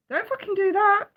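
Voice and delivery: ordinary talk, nothing marked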